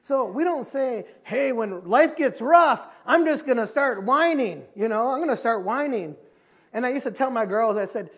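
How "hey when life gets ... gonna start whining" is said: loud, high-pitched mock protest; mock complaint. Preacher acting out people who whine when life gets rough, mock complaint. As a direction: declare it loudly, drawing out the words.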